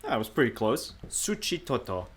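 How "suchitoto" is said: soft, raised-pitch imitation of the Spanish accent; putting on the accent. Vlogger copies the translator's pronunciation, putting on a Spanish accent. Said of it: "Why am I doing the accent?".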